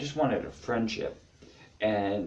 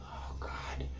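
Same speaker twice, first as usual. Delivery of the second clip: whispered exasperated groan; weary mock whisper; 15 dB quieter, much slower than usual. Family members dreading a needy relative, whispered exasperation. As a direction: dread it under your breath. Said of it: "even family members still like".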